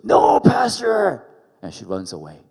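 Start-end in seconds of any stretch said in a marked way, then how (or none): 0.0-1.3 high, loud, drawn-out shriek of no; shrieked refusal. Retold or imitated. Grace running from the pastor's office, shrieking no. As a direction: scream it in alarm. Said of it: "She goes".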